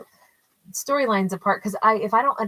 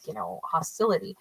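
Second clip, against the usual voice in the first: ordinary talk, nothing marked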